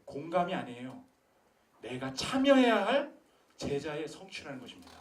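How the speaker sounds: loud, high fervent preaching; preaching at full force. Acted. Pastor challenging the congregation, loud fervent preaching. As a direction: drive it home to the pews.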